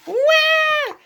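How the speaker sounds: high squealing elephant trumpet; trumpet blast. Acted. Playing the trumpet for Piggy, a high blast. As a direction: blare like a toy trumpet.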